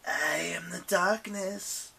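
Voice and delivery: slow breathy whispered hiss. Acted. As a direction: hiss it out very slowly. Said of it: "hissed Eris".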